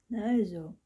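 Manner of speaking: loud, pleased "Na also"; satisfied growl. A narrator's reading. Vampire finishes painting Anton's face, satisfied. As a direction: grumble it out, content with the work. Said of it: "befriedigt knurrte der Vampir".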